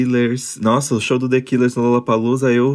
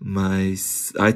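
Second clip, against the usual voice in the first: ordinary talk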